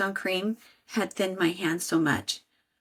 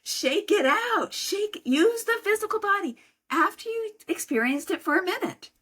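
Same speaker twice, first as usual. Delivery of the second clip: much higher, earnest coaching; urging high; 11 semitones higher than usual. Real, not acted. Coach telling listeners to shake out anger, high and earnest. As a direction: push them to shake it out. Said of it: "I'm serious".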